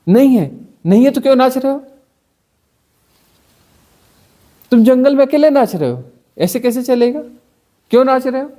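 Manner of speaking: hammed-up disapproving busybody; mock scolding moralist. Mock scolding someone for dancing.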